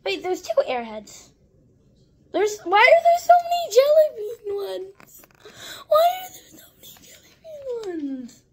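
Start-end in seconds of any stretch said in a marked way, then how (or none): 2.3-8.4 higher, louder half-crying whine; whiny complaint. Real, not acted. Frustrated by the repeat dolls, whining. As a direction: wail about the unfair luck.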